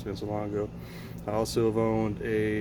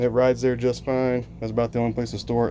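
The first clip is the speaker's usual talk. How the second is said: normal talking voice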